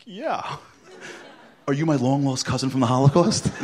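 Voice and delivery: high, loud, laughing question; laughing eagerness. Retold or imitated. He asks caller eagerly, laughing. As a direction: ask hopefully through laughter.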